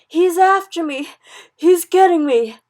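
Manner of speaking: high, very loud cry for help; terrified scream. A narrator's reading. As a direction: scream in nightmare panic. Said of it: "screamed the Flesh Lump Eater".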